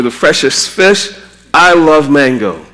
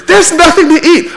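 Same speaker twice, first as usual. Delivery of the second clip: high-pitched whining child, hammed-up; whiny kid; 12 semitones higher, 4 dB louder than usual. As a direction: play a kid whining into the cupboard. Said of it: "my kids have told me so many times".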